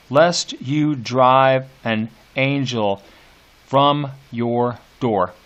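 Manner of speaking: slow and weighty, beats marked; slow metrical reading. Shown as a demonstration. Teacher reading the last lines slowly to show iambs. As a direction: read it slow and measured for the meter. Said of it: "if you're paying attention here".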